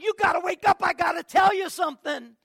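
loud, high, eager wake-up call; excited. Retold or imitated. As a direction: shake someone awake with news. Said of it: "I'm like".

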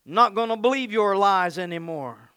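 loud defiance, easing down from a shout; defiant declaration. Acted. Preacher refusing to believe the lies, loud defiance. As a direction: declare it firmly and loudly.

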